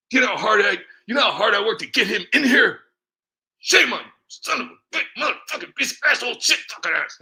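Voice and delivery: high, loud shouted parody of outrage; mock furious rant. Vlogger parodying an outraged congressman supporter, shouting. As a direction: scream it like an outraged fan.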